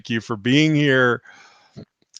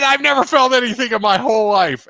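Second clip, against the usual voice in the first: mock angry client; 10 semitones higher, 4 dB louder than usual. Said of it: "And she was so angry".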